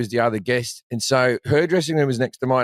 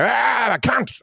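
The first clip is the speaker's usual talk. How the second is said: sharp, high mock heckle in an Australian voice; Aussie heckler yell; 10 semitones higher, slower than usual. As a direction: fire it off like a rowdy Aussie heckler. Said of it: "they'll just go".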